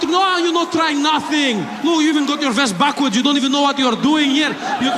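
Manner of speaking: put-on high, accented indignant tirade; scolding passenger. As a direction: berate him in a put-on Middle Eastern accent. Acts passenger scolding terrorist.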